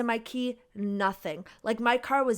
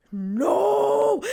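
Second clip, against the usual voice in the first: sharp, higher, louder furious no; angry no; 9 semitones higher, 4 dB louder than usual. Retold or imitated. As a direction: bark it like you're about to snap. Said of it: "'Cause I was so mad".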